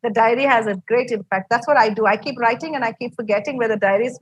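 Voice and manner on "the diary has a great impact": ordinary delivery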